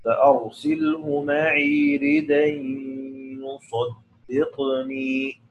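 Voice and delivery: slow recited ردا يصدقني with ردا ending in a tanween "radan" joined on; Quranic recitation. Shown as a demonstration. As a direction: chant ردا يصدقني slowly, keeping the fatha tanween on ردا. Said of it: "بالفتح هكذا".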